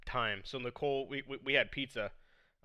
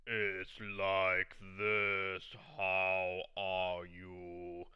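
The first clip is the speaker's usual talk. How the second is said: very slow, lower, heavy voice; deep King Kai; 7 semitones lower, 4 dB quieter, much slower than usual. Retold or imitated. Showing King Kai's Japanese voice, slow and deep. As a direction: drag every word out deep. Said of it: "But in the Japanese version".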